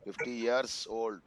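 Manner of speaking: spelled out slowly for the student; teacher slowing down to model it. Shown as a demonstration. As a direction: lay out each word slowly. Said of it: "teacher models the correct phrase".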